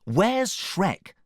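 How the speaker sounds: high, urgent question; Fiona, demanding. A narrator's reading. Fiona asks after Shrek, high and urgent. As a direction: ask it high and insistent. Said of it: "asked Fiona".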